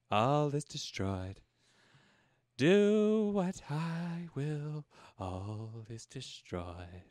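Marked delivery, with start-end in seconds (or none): 0.1-1.4 slow, hammed-up posh English despair; dramatic despair. Posh English lady reading her doom slowly. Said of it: "she got nihilistic as fuck". 5.2-7.0 slower, much softer posh English despair; deeper despair. Acted. Posh English lady reading her doom again, slower and fading. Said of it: "she got nihilistic as fuck".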